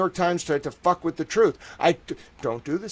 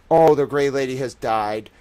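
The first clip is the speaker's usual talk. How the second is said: ordinary delivery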